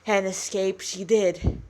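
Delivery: usual speaking voice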